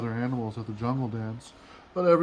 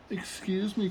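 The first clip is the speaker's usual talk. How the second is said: polite cricket; 7 semitones higher than usual. Acted. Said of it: "coughed a cricket".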